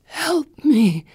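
high, choked gasping plea; strangled whisper. Acted. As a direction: squeeze it out as if hanged. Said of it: "she says in a strangled whisper".

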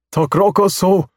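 high, loud alien speech. Acted. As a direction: bark out strange alien words. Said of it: "the alien says".

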